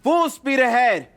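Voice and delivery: high, very loud command; rally the crew with a shout; forceful commander's yell; yelled order. A narrator's reading. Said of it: "He then yells".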